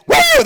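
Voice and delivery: long rising crowd roar, loud and high; crowd roaring whoa. Retold or imitated. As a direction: belt out a stadium "whoa" like a diesel engine. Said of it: "that diesel sound when he ran".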